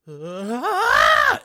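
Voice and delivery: sudden loud mock scream; high sudden yelp of a sleeper; retold scream. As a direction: belt out a startled dream scream. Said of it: "and he goes".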